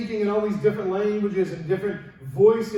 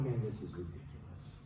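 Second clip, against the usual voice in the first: much lower and softer, muttered under the breath; muttered disbelief; 12 semitones lower, 15 dB quieter than usual. Retold or imitated. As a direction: grumble in disbelief to yourself. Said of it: "he's looking at this going".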